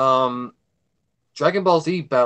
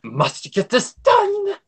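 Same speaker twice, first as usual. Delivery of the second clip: much higher and slow, a wound-up mock panic; frantic editor; 9 semitones higher, slower than usual. Mocking himself while editing, in a high, slow, mock-frantic voice. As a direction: act out your own deadline frenzy, dragging each word.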